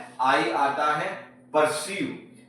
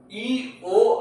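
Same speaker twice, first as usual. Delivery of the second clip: letters spelled out slowly; 6 semitones higher, 4 dB louder than usual. Shown as a demonstration. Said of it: "ई ओ को ईओ से पढ़ेंगे".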